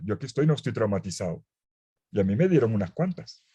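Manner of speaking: usual speaking voice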